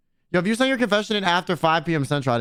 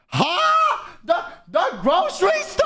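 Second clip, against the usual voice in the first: shocked high huh; 12 semitones higher, slower than usual. Retold or imitated. Reacting to a date dragging him to the grocery store, high mock shock.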